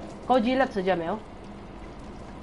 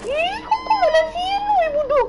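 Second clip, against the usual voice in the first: very high, slow crying squeal of panic; panicked cry; 5 dB louder, slower than usual. Real, not acted. Gamer shrieking at her character in panic, high crying squeal. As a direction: shriek it out near tears.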